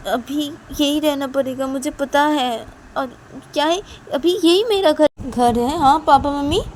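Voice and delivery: high, loud daughterly protest; upset protest. Acted. Upset daughter protests to her parents.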